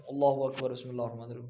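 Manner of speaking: اللہ اکبر run straight into بسم اللہ with no pause; rushed takbir with no break before بسم اللہ; hurried careless prayer opening; wrong, rushed way. Shown as a demonstration. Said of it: "تو یہ طریقہ درست نہیں ہے".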